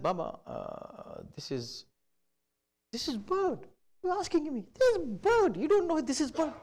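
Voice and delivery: high-pitched exasperated son's voice; irritated son. Retold or imitated. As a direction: snap back at the father impatiently. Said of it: "He was so angry".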